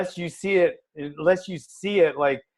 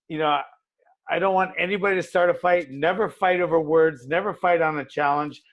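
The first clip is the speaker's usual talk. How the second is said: normal talking voice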